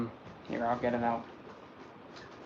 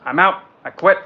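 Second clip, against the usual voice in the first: loud, higher "nope I'm out"; quitting; 3 semitones higher, 6 dB louder than usual. Retold or imitated. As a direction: flounce off with a loud quit. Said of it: "I'm thinking".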